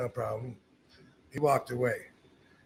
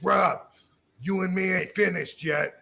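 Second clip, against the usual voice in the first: called from afar; 3 semitones higher than usual. Retold or imitated. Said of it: "from far away goes".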